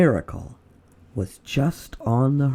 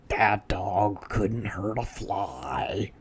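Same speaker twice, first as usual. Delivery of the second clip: softer, lower and slow; slow whisper; 4 semitones lower, 7 dB quieter, slower than usual. Acted. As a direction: hush it out lazily. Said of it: "whispered one burglar".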